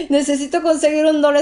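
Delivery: a hint of panic, retold; hysterical. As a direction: play at being hysterical about finding a dollar. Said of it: "estaba histérica".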